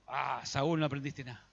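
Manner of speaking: a let-down mentor shaking his head; weary disappointment. Acted.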